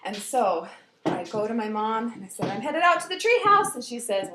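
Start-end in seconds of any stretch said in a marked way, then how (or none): 2.5-3.7 put-on high, loud excited kid; eager announcement. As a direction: announce it to mom on the way out. Child announcing plans to mom.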